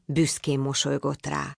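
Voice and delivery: ordinary delivery, nothing marked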